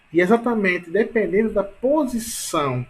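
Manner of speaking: normal talking voice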